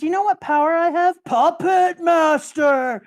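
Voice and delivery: villain reveal. Retold or imitated. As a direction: drag out puppet master menacingly. Villain reveals his power.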